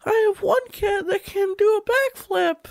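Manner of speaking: very high, tearful cat lady; squeaky old cat lady; crazy cat lady voice. Acted. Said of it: "I am a crazy cat lady".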